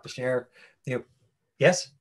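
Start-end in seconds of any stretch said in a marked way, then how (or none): none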